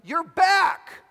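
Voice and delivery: high, loud cry of surprise; acted shout. Wife bursting out of the trailer at her husband's return, shouting. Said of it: "Charlie's wife burst out of the trailer shouting".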